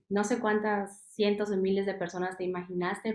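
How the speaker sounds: ordinary talk, nothing marked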